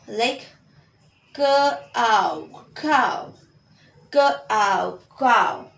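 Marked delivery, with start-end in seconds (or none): 1.3-3.3 k ow, then cow, built from its sounds; sounded out then blended. Shown as a demonstration. Teacher blending cow sound by sound for students. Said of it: "So O W makes".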